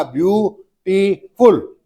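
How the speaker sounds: "ब्यूटीफुल" slowly as ब्यू, टी, फुल; divided into three parts. Shown as a demonstration. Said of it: "तीन part में divide कर दिया".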